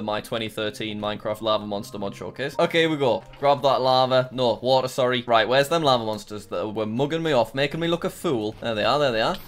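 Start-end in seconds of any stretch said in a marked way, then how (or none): none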